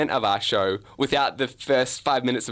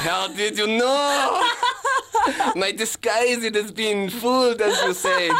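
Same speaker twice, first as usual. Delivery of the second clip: high, hammed-up foreign accent, German meant; German detective's accent; 9 semitones higher, slower than usual. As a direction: wail in a bad German accent, found out. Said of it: "You can drop the accent".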